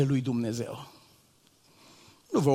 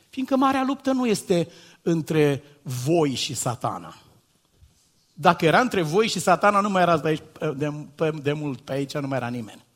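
ordinary talk

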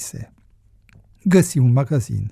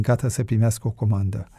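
plain reading voice

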